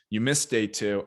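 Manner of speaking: usual speaking voice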